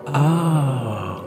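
long sung "ah"; sung cry of voices. A narrator's reading. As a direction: hold the vowel, letting it fall away. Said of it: "chantèrent les voix".

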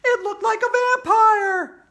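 very high, loud squeal; Andrea, alarmed. Acted. Andrea answering AJ on air, high alarm. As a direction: squeal it out at top pitch.

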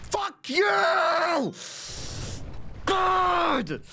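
high, drawn-out cry of rage; frustrated yell. Real, not acted. Player cursing rival after a hit, high frustrated yell. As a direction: wail it out in exasperation. Furious at losing.

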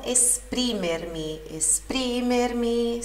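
"esprimermi" slowly, es-PRI-mer-mi; stress on PRI. Shown as a demonstration. Teacher modelling for students, slow stressed PRI in "esprimermi". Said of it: "sílaba tônica no pri".

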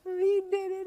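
lightly voicing her crying son, pitch up; bawling little boy. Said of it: "he started bawling and he says".

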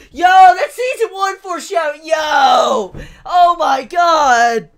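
loud outburst of stunned recognition; shocked shouting. Real, not acted. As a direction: shout it out as the realisation hits. Realizes the foreshadowing, shocked.

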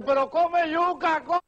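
high, drawn-out exasperated cry; acted wail at a dog, stretching the words; exasperated plea. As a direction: plead in a high, slow holler. Exasperated man pleads with dog.

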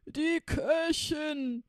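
high, loud, slow roar; roaring laugh-shout. Acted. Robbers roaring with laughter at the new cook, loud shout. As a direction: bellow it out gleefully. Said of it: "brüllten die Räuber lachend".

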